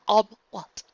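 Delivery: high, loud, startled query; uneasy question. A narrator's reading. Luna asking what Ella means, startled. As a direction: blurt it out warily. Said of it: "Luna asked".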